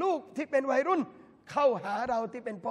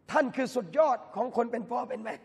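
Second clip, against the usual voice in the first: ordinary talk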